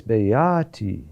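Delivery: beati slow and low, lifting on the A, falling gently after. Shown as a demonstration. Teacher showing natural intonation of the word, beati lifted on A. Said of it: "accent is on a higher note".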